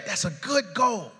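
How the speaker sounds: usual speaking voice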